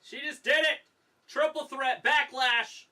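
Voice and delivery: high, loud, thrilled shout; excited shout. Real, not acted. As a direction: yell it like the match just turned. Reacting live to match.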